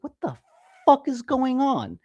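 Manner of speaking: mock freaked-out exclamation; retold bewilderment. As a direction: act out being baffled, stretching the words. Retold startled reaction to whisper.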